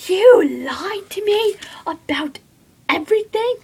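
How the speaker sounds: high-pitched, mock-outraged accusation; high, hurt disbelief; shocked accusation. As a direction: act out feeling betrayed, voice jumping up. Retelling a character's hurt accusation.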